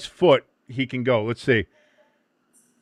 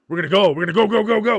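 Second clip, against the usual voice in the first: louder and rising chant of go; building hype; 5 semitones higher, 6 dB louder, much faster than usual. Pretend. Urging the US team forward, building chant. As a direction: pump it up like a coach.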